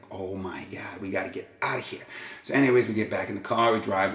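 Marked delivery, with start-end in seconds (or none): 0.1-2.1 much softer, urgent under the breath; panicked hush. Retold or imitated. Telling Vin they must leave, soft panicked hush. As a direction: whisper the panic to your buddy. Panicking quietly about the cops.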